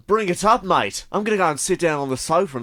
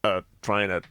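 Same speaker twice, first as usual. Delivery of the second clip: gangster; 7 semitones lower, 4 dB quieter than usual. Acted. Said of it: "Gangster movie".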